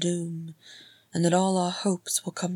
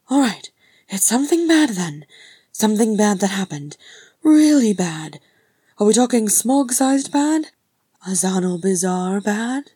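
higher, loud run of guesses; agitated questioning; 4 semitones higher, 9 dB louder than usual. Acted. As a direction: press him with rising questions. Frerin pesters with anxious guesses.

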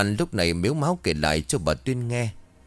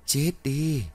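eerie ghost curse; 7 semitones higher than usual. A narrator's reading. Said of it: "Một dòng cười ghê sợ vang lên".

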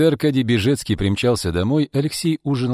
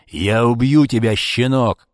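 loud, raised, furious threat; furious yell; 3 semitones higher, 5 dB louder, slower than usual. A narrator's reading. Arkady lunges at his son, yelling. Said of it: "завопил он".

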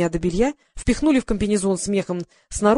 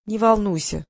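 ordinary delivery, nothing marked

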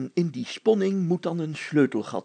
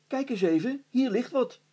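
higher, eager call of discovery; 8 semitones higher than usual. A narrator's reading. Hannes calling to the mayor in the cellar, eager. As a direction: Hannes calls out, pleased with his find. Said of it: "riep Hannes".

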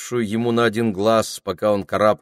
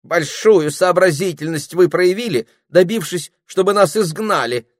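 high, loud, snippy jab; huffy sarcastic comeback; snorting retort; 10 semitones higher, 6 dB louder than usual. Acted. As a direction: sneer back at the parrot. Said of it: "фыркнула Дульчебелла".

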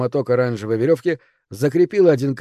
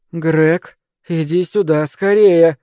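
urgent call, rising to louder and higher; agitated; 4 semitones higher, 5 dB louder, much slower than usual. A narrator's reading. Girl calling companion to the cabin, agitated. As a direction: summon someone, growing more urgent. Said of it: "голос прозвучал непривычно взволнованно".

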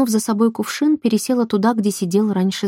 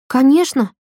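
higher, quick burst of agreement; eager interjection; 6 semitones higher, 4 dB louder than usual. A narrator's reading. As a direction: jump in eagerly with it.

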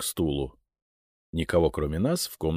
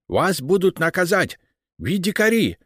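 high, loud, anxious foreign protest; agitated Englishman in broken Russian; English accent Russian; 12 semitones higher, 6 dB louder than usual. Acted. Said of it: "заволновался англичанин". (английский акцент, взволнованно)

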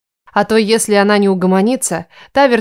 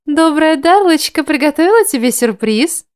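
cooing; 7 semitones higher than usual. A narrator's reading. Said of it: "Чуть ли не сюсюкала она".

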